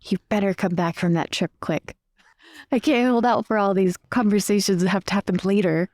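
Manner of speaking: ordinary delivery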